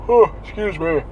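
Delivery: mid-yawn talking. Real, not acted. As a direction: yawn through the words. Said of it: "See the yawning".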